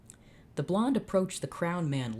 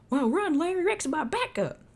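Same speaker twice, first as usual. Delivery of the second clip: high, loud cowboy shout; Jed voice; 13 semitones higher, 5 dB louder than usual. Acted. Cowboy Jed shouting to Larry to run from the T-Rex. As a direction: yell a warning like the cowboy. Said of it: "can't I not do Jed".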